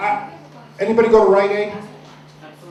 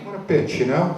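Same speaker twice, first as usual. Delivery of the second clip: normal talking voice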